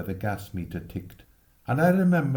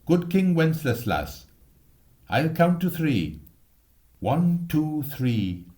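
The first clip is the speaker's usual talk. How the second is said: put-on bossy boy; 5 semitones higher, 3 dB louder than usual. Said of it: "Jack said".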